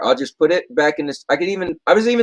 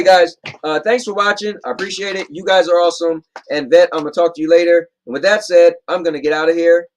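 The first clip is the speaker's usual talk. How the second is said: usual speaking voice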